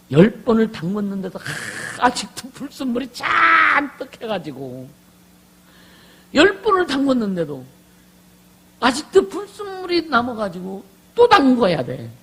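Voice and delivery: high, shouted preaching with long-drawn stressed words; full-force preaching. Real, not acted. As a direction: cry it out at full volume, stretching the key words. (목청껏 높게 외치는 설교)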